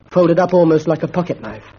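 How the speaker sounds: plain reading voice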